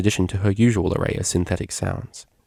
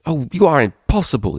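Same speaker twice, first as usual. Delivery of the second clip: high, raised, exasperated cry; exasperated exclamation; 7 semitones higher, 3 dB louder than usual. Acted. Earth Pony scolds the teasing DJ, exasperated and raised. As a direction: throw up your hands in protest. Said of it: "exclaimed the exasperated Earth Pony".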